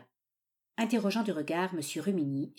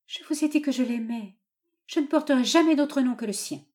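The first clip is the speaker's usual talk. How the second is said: higher, louder declaration of love; mildly acted defiant daughter; resolute daughter; 7 semitones higher, 5 dB louder than usual. A narrator's reading. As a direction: stand up to father, voice lifted.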